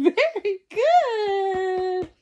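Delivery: slow, laughing praise; real delighted laugh, drawn out slowly. Real, not acted.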